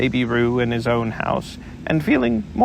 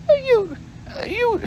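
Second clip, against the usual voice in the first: much higher, stammered splutter; spluttering Piglet. Acted. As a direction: splutter it high through soap. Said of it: "spluttered Piglet".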